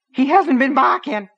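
high, thin and screamy, like helium; high thin scream. A narrator's reading. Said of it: "Nettie's voice was high and thin and screamy".

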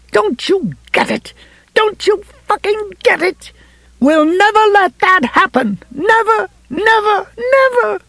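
very high, frantic rage, climbing; screaming fury. A narrator's reading. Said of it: "she screamed".

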